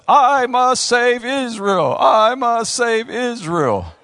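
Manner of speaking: loud, high, drawn-out mock-heroic declaration; slow, booming singsong vow; cartoon hero. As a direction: proclaim it like a little cartoon champion. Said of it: "Now, VeggieTale says".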